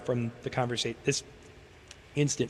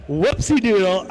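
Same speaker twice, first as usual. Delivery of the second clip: silly voice; 6 semitones higher, 7 dB louder, slower than usual. Pretend. Silly cover-up after near slip.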